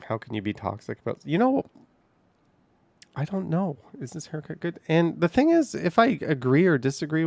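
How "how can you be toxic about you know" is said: ordinary delivery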